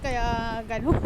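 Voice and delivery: ordinary talk, nothing marked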